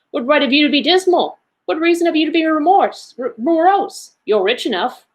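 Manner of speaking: high, lilting playful retort; teasing, sing-song comeback; gaily. A narrator's reading. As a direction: toss the question back merrily. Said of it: "returned the ne-, nephew gaily".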